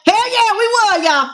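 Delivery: high gleeful brag; gleeful gloating. Retold or imitated. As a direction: crow it high and excited. Coworker gloats about lottery win.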